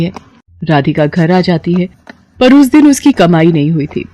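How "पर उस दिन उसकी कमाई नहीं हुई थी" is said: ordinary delivery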